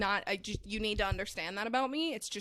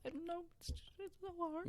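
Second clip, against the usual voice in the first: mock crying; 8 semitones higher, 15 dB quieter than usual. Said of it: "You don't need to cry".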